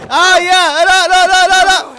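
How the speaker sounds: high, loud panicked no no no; real fright as the monster appears; scared wail; panicked cry. Real, not acted. Panicked at boss in game.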